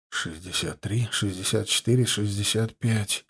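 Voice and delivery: hushed whispered counting; tense whispered countdown. A narrator's reading. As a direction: count under your breath, tense. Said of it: "шёпотом считал Тимофей". (напряжённый шёпот, счёт)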